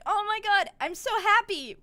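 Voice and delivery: high, loud gush of delight; hammed-up happiness; overjoyed. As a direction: gush as the smitten prince. Prince overjoyed, acted.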